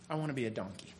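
low, very soft confession; hushed reveal. Real, not acted. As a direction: drop right down and admit it quietly. Deadpan reveal of his go-to animal.

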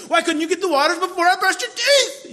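high, loud exasperated yell; inner angry yell. Retold or imitated. Dad inwardly yells at kids over waters, exasperated shout. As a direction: let the frustration burst out. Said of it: "that's what I'm thinking".

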